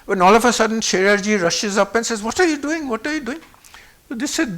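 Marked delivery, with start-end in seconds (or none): 2.2-3.4 high, urgent retold protest; alarmed challenge. Sheriarji rushes up to rescue his friend, alarmed. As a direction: rush in with a high-pitched challenge.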